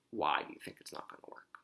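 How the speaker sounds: usual speaking voice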